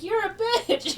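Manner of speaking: put-on high, childlike blunt insult; nephew's high-pitched put-down; little kid voice. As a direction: say it like an innocent small boy.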